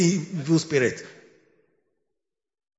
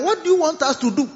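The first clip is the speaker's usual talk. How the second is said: raised, fast exasperated complaint; exasperated whine; 8 semitones higher, faster than usual. Retold or imitated. Preacher voicing a fed-up crowd: exasperated, quick and raised. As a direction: throw up your hands, what more do you want.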